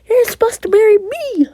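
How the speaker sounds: high, loud indignant cry; jilted outburst. Acted. Jilted girl to her ex at the proposal, loud high outburst. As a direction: shriek it like you were betrayed.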